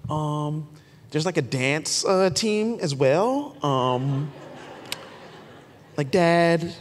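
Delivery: lightly voiced hesitant kid, full of fillers; imitated preteen daughter. Dad imitates daughter asking for dance team, halting preteen talk. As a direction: stall and drag out the request like a preteen. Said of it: "Who talks like this?".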